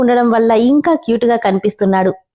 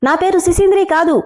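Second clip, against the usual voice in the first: higher mock shout of protest; shouting back; 7 semitones higher than usual. A narrator's reading. Boy shouts back at the teasing friends, higher mock yell. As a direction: yell it back, pitched up and indignant. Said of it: "గట్టిగా అరిచి చెప్పాడు".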